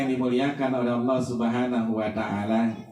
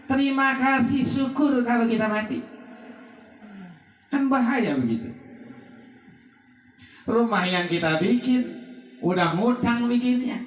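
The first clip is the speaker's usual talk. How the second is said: raised, emphatic sermon voice; fervent preaching; 11 semitones higher than usual. Acted. Preacher warning the funeral crowd, raised and emphatic. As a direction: drive the warning home to the mourners.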